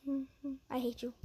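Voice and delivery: a soft teasing "I hate you" to the viewer; mock hate, half-whispered. To the scammer watching, a soft joking "I hate you". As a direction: drop to a hushed mock threat.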